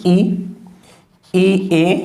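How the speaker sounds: usual speaking voice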